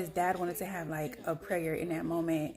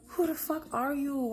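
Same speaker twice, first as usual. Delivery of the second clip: higher, slow, drawn-out disbelief; incredulous disbelief; 7 semitones higher, 4 dB louder than usual. Retold or imitated. Facing the boyfriend on the couch, incredulous and slow. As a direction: stare him down in total shock.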